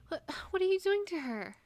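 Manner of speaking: high loud frantic yell; panicked shout. Acted. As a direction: cry out in panic. Running toward wife's attackers.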